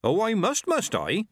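put-on cheeky, high old woman; high teasing old witch. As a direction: tease him, high and bright. Nanny Ogg teasing.

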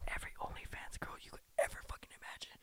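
whispered like a secret tip, no voice; conspiratorial whisper. Real, not acted. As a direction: spill the secret in a hushed whisper. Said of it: "I'm gonna be a whistleblower".

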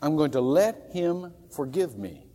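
ordinary talk

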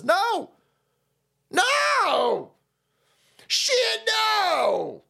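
high yelled protest; shouted no. Acted. Refusing the magazine plan, high shouted no. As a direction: cry out in protest.

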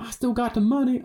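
high-pitched mock American boxer accent; Floyd Mayweather. Answering the can't-read joke as the boxer, mock American accent. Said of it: "do a Floyd Mayweather".

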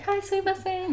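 high sing-out polite greeting; theatrical politeness. Acted. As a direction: put on a very high, theatrical courtesy. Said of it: "you just do the theatrical thing".

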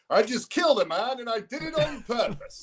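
high put-on voice, far from his own; villain quote. Retelling a movie scene to his friend, in a high put-on voice. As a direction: voice the deranged killer way up high. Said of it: "He goes".

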